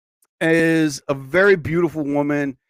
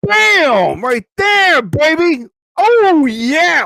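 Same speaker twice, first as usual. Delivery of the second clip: hype shout; 10 semitones higher, 6 dB louder, much slower than usual. Real, not acted. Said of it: "hype shout".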